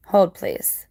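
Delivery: ordinary talk, nothing marked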